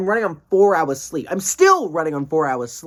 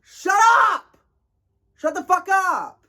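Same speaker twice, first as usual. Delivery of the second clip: high, loud drawn-out outburst; exasperated yell; 3 dB louder, slower than usual. Retold or imitated. Fed-up commuter at screaming girls, exasperated shout.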